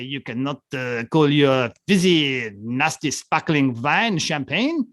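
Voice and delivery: slow, exaggerated German-accented mock official; hammed-up German accent, spoken slowly. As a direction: put on a German accent and drag out the words. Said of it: "The German accent".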